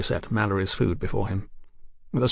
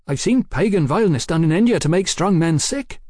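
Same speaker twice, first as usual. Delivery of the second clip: higher-pitched outraged soldier's voice; indignant soldier; 7 semitones higher, 4 dB louder than usual. Acted. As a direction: speak up with a soldier's moral disgust.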